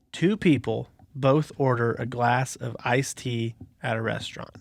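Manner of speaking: slow, deliberate riddle read. Acted. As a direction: read it out slowly for suspense. Reads the riddle aloud slowly.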